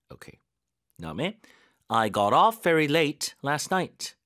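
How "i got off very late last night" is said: slow, "off" stressed; weight on "off"; slow stress drill. Shown as a demonstration. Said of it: "강세를 어디다 넣어야 되는지".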